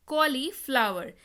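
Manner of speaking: Cauliflower slowly, as कॉली, flower; slow, measured repeat. Shown as a demonstration. Teacher repeats the new word for learners, cauliflower slowly in parts. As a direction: stretch cauliflower out in two clear parts.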